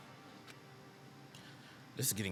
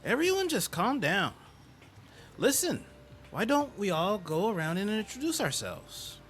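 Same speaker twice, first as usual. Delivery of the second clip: high peacemaker voice; 10 semitones higher than usual. Acted.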